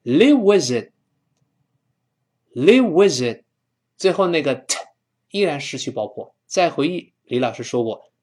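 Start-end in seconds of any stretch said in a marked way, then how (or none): 0.0-3.4 "live with it" run together, higher and louder; emphatic drill of "live with it" as one chunk; linked "live with it". Shown as a demonstration. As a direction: link it as "li-wi-zit", final t unreleased. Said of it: "放在一块儿连读".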